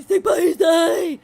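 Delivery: loud, high mock gangster shout. Viewer mimicking her gangster voice, loud and mocking. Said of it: "that's her gangster voice?".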